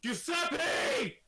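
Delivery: shouting. Acted. Urzer calling loudly.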